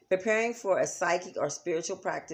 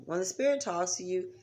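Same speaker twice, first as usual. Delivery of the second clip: normal talking voice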